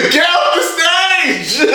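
high, drawn-out mock yell with laughter; heckling shout. Crowd booing the contestant, imitated heckling shout. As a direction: shout like a jeering audience member.

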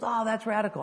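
high, excited mock gush; impressed reaction. Hearing the pastor's story, excited and impressed. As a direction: react wowed, pitched well up.

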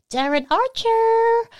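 high, drawn-out roll-call cheer; big high-pitched welcome shout; announcing an arrival. Real, not acted. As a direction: call the name out loud, stretching the end. Calls out chatroom arrivals.

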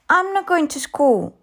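put-on high-pitched child's refusal; little girl refusing. A narrator's reading. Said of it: "Chris said".

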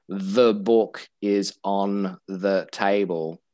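every word hit evenly and slowly; "The book is on the table" with each word given equal weight, said very slowly; stressing every word. Retold or imitated. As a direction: imitate a learner giving each word the same stress. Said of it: "There's basically stressing every single word".

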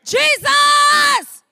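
high, full-throated, sustained shout; strong exclamation. Shown as a demonstration. Preacher shows the Bible's exclamation points, strong exclamation. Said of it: "It's a strong exclam".

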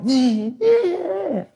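high, drawn-out mock baby wail; imitated baby crying. Dad mimicking his friends' baby starting to cry, mock wail. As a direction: wail like an upset baby, put on. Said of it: "Macy starts crying".

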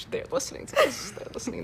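barely audible conspiratorial whisper; hushed whisper. Real, not acted. To her cousin about someone listening, hushed whisper. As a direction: whisper it so they won't overhear. Afraid the person will overhear.

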